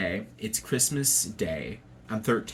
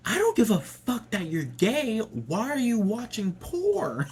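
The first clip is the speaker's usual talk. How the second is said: raised, higher-pitched dad voice, slowing on porn; dad raising his voice; 12 semitones higher than usual.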